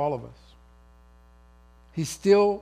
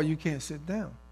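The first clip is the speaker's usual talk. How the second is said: normal talking voice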